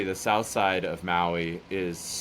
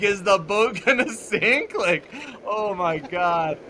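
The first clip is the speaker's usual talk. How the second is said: high-pitched, incredulous retold freak-out; comic disbelief, pitched way up; mock alarm; 10 semitones higher than usual. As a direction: squeal the question, then drag out the exclamation. Retelling his alarmed reaction to Dave.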